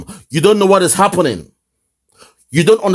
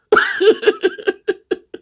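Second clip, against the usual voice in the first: high cackling laugh; high amused laugh; real laughter. Real, not acted. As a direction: burst out laughing. Laughing at guest.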